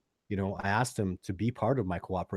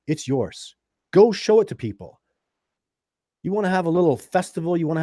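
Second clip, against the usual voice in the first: passionate, loud; 4 semitones higher, 10 dB louder than usual. Real, not acted. Passionate offer to a fan.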